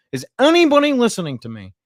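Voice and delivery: loud, high plea for attention; exasperated cry. Acted. Streamer to his tiny audience, exasperated cry. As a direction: call out as if nobody hears. Acts out his constant worried thought.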